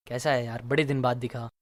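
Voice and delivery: low, soft, emotional greeting. Shown as a demonstration. Friend meeting you after many days, emotional. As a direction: drop low and quiet, full of feeling. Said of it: "emotional tone में बोले".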